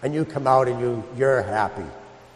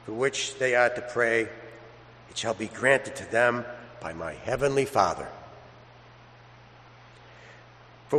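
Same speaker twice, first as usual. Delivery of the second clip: gospel reading; 4 semitones lower, 6 dB quieter than usual. Acted. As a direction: read it reverently, unhurried, to the congregation. Priest proclaims the Gospel.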